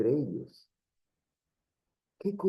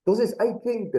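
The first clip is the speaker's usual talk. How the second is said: ordinary talk, nothing marked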